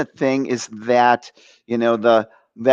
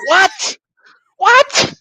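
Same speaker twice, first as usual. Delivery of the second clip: very high, loud squawk; hammed-up shock, pitched way up; incredulous "what"; 4 dB louder than usual. Acting out stunned reply to priest.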